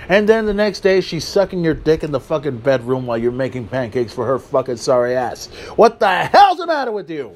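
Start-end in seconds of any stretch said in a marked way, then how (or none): none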